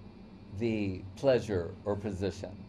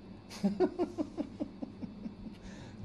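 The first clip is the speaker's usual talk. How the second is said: quiet breathy laugh; 7 dB quieter than usual. Real, not acted. Chuckle mid-lecture.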